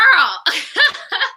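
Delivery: sudden high burst of laughter. Real, not acted. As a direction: crack up, up high. Laughs after Girl.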